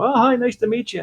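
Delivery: a sing-song, overly polite greeting. Retold or imitated. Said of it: "And you go".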